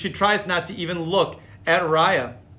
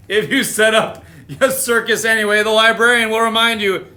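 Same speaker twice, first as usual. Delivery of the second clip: plain reading voice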